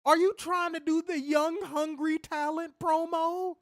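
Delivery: high-pitched, loud exasperation; incredulous rant. Real, not acted. Podcaster ranting about wrestler's promo, high and incredulous. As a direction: push up high as you call out the absurdity.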